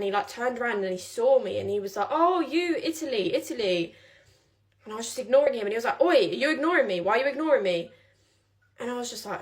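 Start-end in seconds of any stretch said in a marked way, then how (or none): 2.1-3.9 higher, louder call after her; lightly voiced drunk shouting across the station; mock drunk calling. Retelling the drunk man calling after her, loud. 6.0-7.9 louder, higher aggressive drunk, hammed-up; mock angry oi. Retelling the drunk man confronting her, mock angry.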